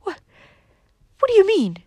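high, loud stammered disbelief; aghast gasp. A narrator's reading. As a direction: gasp it out, scandalized. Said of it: "Cousin Stickles stared and gasped".

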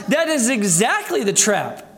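ordinary delivery

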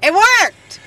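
high, loud, real exultation; triumphant shout. Real, not acted. Storyteller amazed her airport argument succeeded, triumphant shout.

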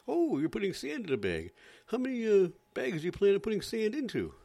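high, loud fake amazement; hammed-up reporter, astonished; mock reporter. Mock reporter, over-dramatic.

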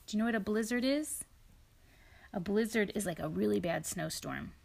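usual speaking voice

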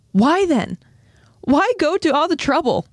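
high, pressing questions; acted emotional interrogation; insistent questioning. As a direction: demand an answer with mounting urgency. Historia demands answers from Ymir.

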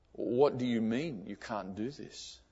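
father asking his son in hushed, wounded disbelief; hurt disbelief. Retold or imitated. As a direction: ask it as if the floor dropped away. Father hears son won't become monk.

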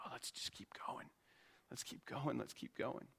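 hushed, eager whisper of the congregation. Retold or imitated. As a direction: breathe it like an excited secret. Congregation voiced, eager to continue.

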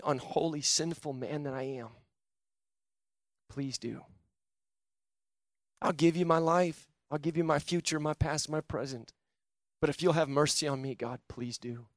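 soft, earnest repeat-after-me prayer; altar-call prayer. Acted. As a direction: pray quietly on their behalf, fading. Leads a quiet sinner's prayer.

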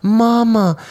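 dad imitating his crying son calling for mom; crying toddler. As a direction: cry out like a sobbing toddler. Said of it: "he's like".

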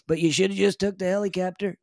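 a bragging Trump line, then said again; hammed-up Trump insisting on the helicopter; Trump repeating himself. Trump advising Tom to take the helicopter, hammed up. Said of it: "I have a bit of a Trump".

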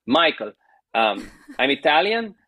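lightly imitated Italian accent; a touch of Italian accent. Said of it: "I'm doing the accent".

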